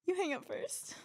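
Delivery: high, cooing lovey voice; the sweet coo of a clingy partner; giggly late night call. Pretend. High teasing final take.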